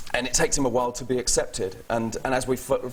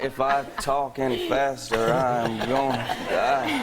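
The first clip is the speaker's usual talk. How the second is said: slow Southern drawl; slower than usual. Shown as a demonstration. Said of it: "I talk quite quickly in my natural thing".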